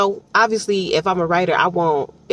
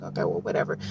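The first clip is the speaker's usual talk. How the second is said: bored; 12 semitones lower, 9 dB quieter, faster than usual. Retold or imitated. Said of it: "you're just gonna be like".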